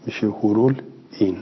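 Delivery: ordinary talk, nothing marked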